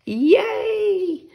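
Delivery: high, bright squeal of joy with a laugh; excited cheer. Acted. Puddle cheering about mud season, excited high yay. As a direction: cheer like a thrilled child.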